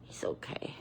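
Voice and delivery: whispered, very soft; barely voiced, soothing; hushed reassurance. Real, not acted. Reassurance after the pet's fall.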